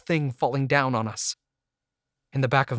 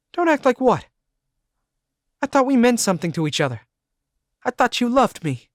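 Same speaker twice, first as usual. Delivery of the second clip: put-on much higher, louder pleading girlfriend voice; Cindy hurt and pleading; 11 semitones higher, 6 dB louder, faster than usual.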